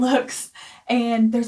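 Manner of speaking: usual speaking voice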